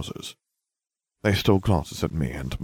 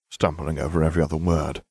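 ordinary delivery

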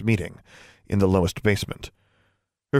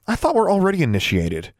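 objecting; 9 semitones higher than usual. A narrator's reading. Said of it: "Alexandra objected".

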